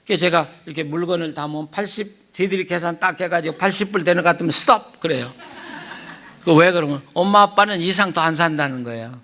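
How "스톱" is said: playful stop call. Retold or imitated. Kids stopping grandpa's shopping.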